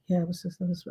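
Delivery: hushed, low mumble; imitating very soft, low talk; super soft talk. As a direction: murmur it under your breath, barely audible. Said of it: "Talk super soft".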